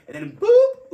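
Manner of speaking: very high, loud sound effect boop; sudden boop. Retold or imitated. Story of lights flashing behind him, a loud high boop. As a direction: pop the word like a siren flash.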